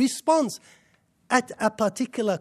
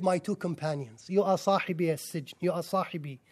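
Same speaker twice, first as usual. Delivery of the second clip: much softer, lower, as if savoring my two companions; tender; 7 semitones lower, 10 dB quieter than usual. Acted. Preacher lingering over the Quran's words for prison companions, tender and soft. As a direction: say it gently, like calling a close friend.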